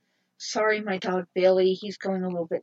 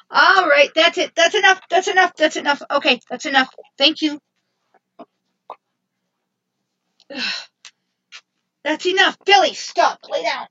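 louder, higher firm telling-off; scolding; 6 semitones higher, 5 dB louder than usual. Real, not acted. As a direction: scold a dog that won't settle. Scolding the misbehaving dog.

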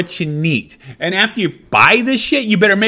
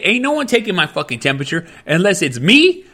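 ordinary delivery

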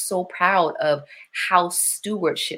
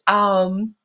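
ordinary delivery

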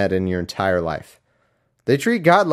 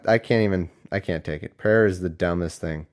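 ordinary delivery

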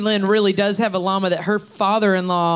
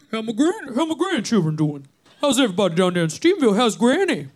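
put-on Pop Pop's Mississippi accent; 2 semitones higher, 3 dB louder than usual. Granddaughter doing Pop Pop asking after the family, thick Mississippi accent. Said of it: "hold on, I'm gonna go into it".

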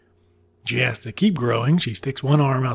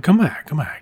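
higher, louder mock pleading call; lightly acted crowd calling someone back; 4 semitones higher, 6 dB louder than usual. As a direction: call after someone who is leaving. Crowd calls the mouse back.

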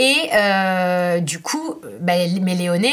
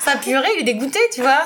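higher, laughing storytelling; amused; 5 semitones higher than usual. Real, not acted. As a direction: tell it, giggling at him. Describes disgusted little son. (raconte en riant)

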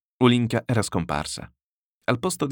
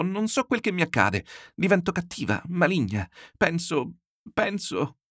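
put-on high, pained young daughter's voice; near tears; 8 semitones higher than usual. A narrator's reading. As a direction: climb higher and fade, close to crying. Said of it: "per poco non si mise a piangere".

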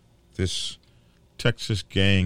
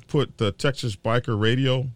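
normal talking voice

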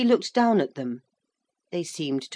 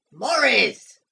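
loud, higher, drawn-out summons; thundering shout; 6 semitones higher, 7 dB louder than usual. A narrator's reading. Mister Basingstoke summons Maurice a second time, thundering. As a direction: bellow the name impatiently. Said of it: "thundered the voice of Mister Basingstoke".

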